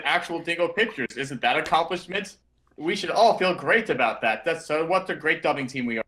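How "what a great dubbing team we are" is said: ordinary delivery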